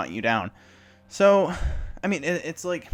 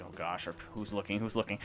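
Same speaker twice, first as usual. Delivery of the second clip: much softer anxious under-breath worry; nervous mutter; 3 semitones lower, 8 dB quieter than usual. Retold or imitated. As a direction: mutter it so nobody hears. Nervously glancing around.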